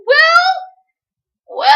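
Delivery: high, loud wailed word; mock anguished shout; acted distressed cry. Reenacts character's distress over Will.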